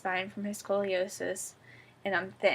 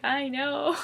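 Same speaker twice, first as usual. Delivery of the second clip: retold sympathetic groan; 6 semitones higher, 6 dB louder than usual. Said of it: "He felt so bad".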